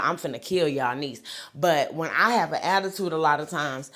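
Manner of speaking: ordinary delivery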